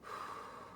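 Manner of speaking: long unvoiced breath blown out; blowing out breath. Shown as a demonstration. Teacher demonstrating a calming breath, blown out. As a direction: blow the anger out in one long breath. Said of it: "Let's blow it out".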